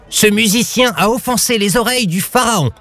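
high outraged vizier cry; a pompous vizier shrieking in offence; high indignant court official; vizier shouting. A narrator's reading. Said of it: "hurla le vizir".

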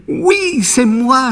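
put-on very high, joyful boy voice; lightly voiced happy puppet finding his papa; overjoyed puppet boy. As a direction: squeal it with delight like a reunited child. Pinocchio reunites with father.

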